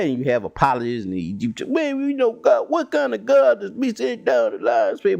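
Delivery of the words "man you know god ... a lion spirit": high, put-on questioning voice; mock doubter. Skeptic questioning the lying spirit, high put-on voice. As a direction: squeak out the doubter's complaint.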